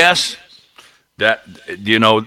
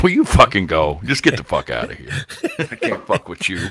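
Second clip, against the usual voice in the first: lower, softer, laughing it out; laughing exasperation; 5 semitones lower, 4 dB quieter than usual. Retold or imitated. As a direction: give up through laughter. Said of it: "she just busted out laughing like".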